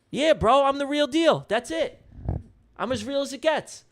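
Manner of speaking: high-pitched, defiant boast; fired up. Real, not acted. Streamer insisting he's the real deal, fired up. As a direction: assert it with the voice pushed up high. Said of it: "He's got me fired up today".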